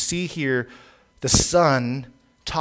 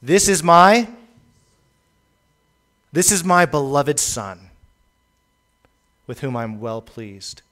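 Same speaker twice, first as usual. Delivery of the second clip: voice from heaven; 5 dB louder than usual. Retold or imitated. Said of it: "a voice came out of heaven saying".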